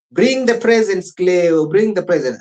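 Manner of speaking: bossing Cleo to hand over the gifts; mock demanding relative. Demanding relatives voiced in retelling.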